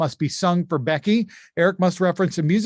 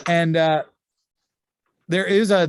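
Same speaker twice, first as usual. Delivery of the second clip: ordinary talk, nothing marked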